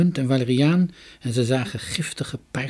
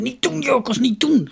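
high-pitched urgent warning; alarmed storybook cry; pleading cry; 12 semitones higher than usual. A narrator's reading. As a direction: beg him to stop, voice pitched right up. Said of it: "riep ze".

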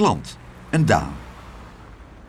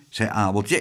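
ordinary delivery, nothing marked

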